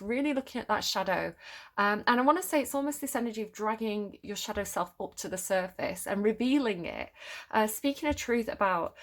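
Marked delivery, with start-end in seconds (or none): none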